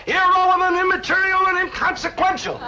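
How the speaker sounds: very high, theatrical lawyer's cry; courtroom objection yell. Shown as a demonstration. Dad demonstrating objections for his son, high-pitched yell. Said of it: "jump up and you yell".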